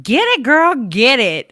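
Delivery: loud, high hype-up; cheering her on. Acted. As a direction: egg on a heroine like her biggest fan. Cheering on the movie heroine.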